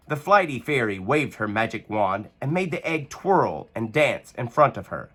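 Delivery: ordinary delivery, nothing marked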